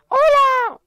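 very high, loud falsetto hello; put-on squeaky shout; squealed greeting. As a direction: shriek a greeting in a cartoon voice. Friend arrives, greeting.

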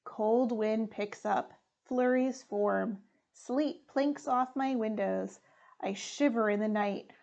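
ordinary delivery, nothing marked

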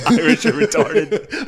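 high, slow laughing punchline. Real, not acted. Friend laughing through the website name, high and slow. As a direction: crack up while saying it.

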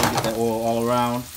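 ordinary talk, nothing marked

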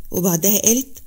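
ordinary delivery

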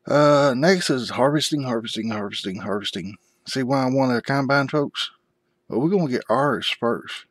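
usual speaking voice

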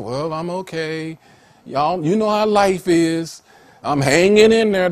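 mock-pitiful, high and dragged out; slow, put-on woe-is-me answer; pitiful reply. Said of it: "supposed to be pitiful like them".

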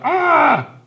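very high, loud scream; frightened yell. Acted. Father seeing the scarier painted face, frightened scream. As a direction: shriek in sudden fright. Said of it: "His father yelled".

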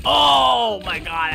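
long, loud drawn-out exclamation; mock horrified cry. Player reacting to the game carnage, loud mock horror.

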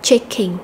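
Checking with the first syllable CHECK stressed; CHECK-ing, first syllable hit. Shown as a demonstration. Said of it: "nhấn âm đầu".

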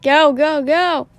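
loud urgent go; urgent shout. Acted. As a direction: push them to run. Urging escape.

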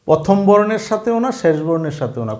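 ordinary delivery

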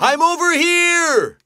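high, sustained holler; calling out. Acted. Daddy shark to searching child, calling out. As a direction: holler so a searching child can find you. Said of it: "It sounds like Daddy's calling me".

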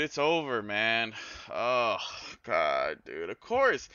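normal talking voice

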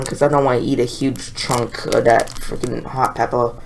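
ordinary delivery

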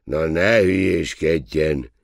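loud, slow brush-off; waiter's irritated retort; gruff dismissal. A narrator's reading. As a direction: snap it out loudly, stretching the words. Waiter brushes off solemn admirer.

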